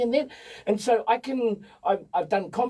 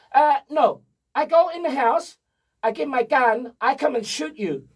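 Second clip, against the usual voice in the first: imitated Eastern European accent, higher and louder; 6 semitones higher, 4 dB louder than usual. The Eastern European homeowner threatening the repo man, in his accent. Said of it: "I think Eastern European, just from his accent".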